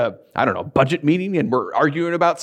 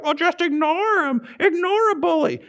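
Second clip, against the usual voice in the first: sing-song mock reassurance, pitched way up; mimicked parents; 11 semitones higher than usual. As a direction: imitate parents handing out useless advice. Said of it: "the parents are always like".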